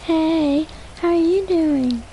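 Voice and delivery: high put-on girly greeting; boy faking a girl's voice to his friend. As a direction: pitch way up to sound like a girl. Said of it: "What does my girl voice sound like?".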